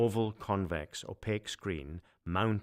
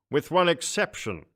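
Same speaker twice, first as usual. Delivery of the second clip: much higher, louder pompous emperor; a booming, self-important ruler; 11 semitones higher, 9 dB louder than usual. Acted.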